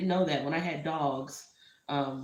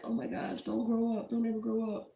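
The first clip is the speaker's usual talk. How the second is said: tender plea; 6 semitones higher than usual. Retold or imitated. Pleading to baby in memory.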